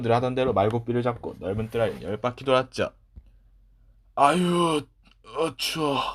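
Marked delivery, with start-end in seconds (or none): 4.2-6.0 higher shivery groan at the cold; shivering from cold. Acted. Boy back from the freezing yard, shivering. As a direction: shudder out the words, freezing.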